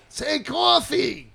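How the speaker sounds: slow, high mock request; imitating pestering locals. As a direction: draw it out high, like a curious out-of-towner. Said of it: "They always say".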